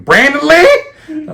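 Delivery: high, slow, drawn-out mom's call of his name. Retold or imitated. Mom catches him after the sister's crash, drawn-out call. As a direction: stretch his full name like an angry mom.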